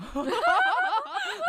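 squeaky embarrassed laugh; high cracking laugh burst; cracked high laugh. Real, not acted. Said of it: "没事，没事，我们重来。".

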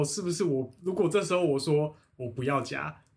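normal talking voice

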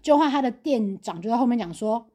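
normal talking voice

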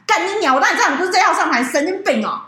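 fast, loud, indignant swearing; angry rant. Real, not acted. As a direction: vent the fury in a rapid burst. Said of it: "我这当下我真的是".

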